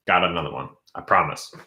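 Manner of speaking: ordinary talk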